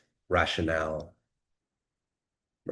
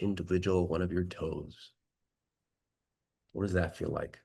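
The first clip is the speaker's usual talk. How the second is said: guided meditation. Acted. Leads a meditation.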